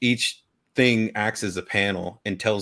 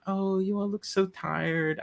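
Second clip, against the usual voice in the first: lightly voiced kind old lady, higher; sweet granny; 10 semitones higher, 4 dB quieter than usual. A granny greeting tired travellers, lightly voiced and higher. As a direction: gently fuss over tired guests. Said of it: "I can't do a Scottish accent".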